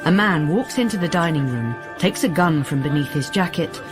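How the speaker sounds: usual speaking voice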